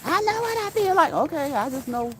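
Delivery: high mocking impression of a stubborn walker; stubborn person. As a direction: squeak it like a defiant know-it-all. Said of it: "they ain't gonna hear you".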